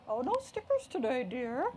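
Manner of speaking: high, singsong nurse voice; sweet nurse. Acted. Nurse telling a boy no stickers today, sweet singsong. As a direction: lift it high, gently soothing a child. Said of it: "said the nurse".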